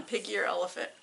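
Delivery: plain reading voice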